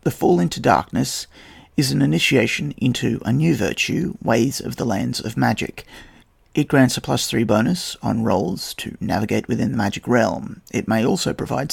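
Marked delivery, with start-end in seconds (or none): none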